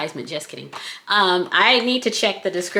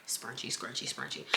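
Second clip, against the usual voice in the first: whispering; 8 semitones lower, 20 dB quieter than usual. Pretend. Whispered playful chant.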